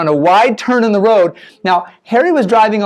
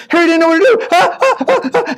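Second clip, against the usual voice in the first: panicked; 9 semitones higher than usual. Acted. Harry panics at oncoming car.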